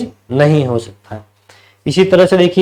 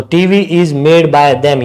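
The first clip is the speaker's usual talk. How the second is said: slow dictation; much slower than usual. Shown as a demonstration. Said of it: "यहाँ तक लिखोगे तो भी सही है".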